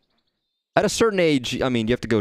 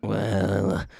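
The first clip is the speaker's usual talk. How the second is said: bad old man voice; much slower than usual. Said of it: "I can't do an old man voice very well".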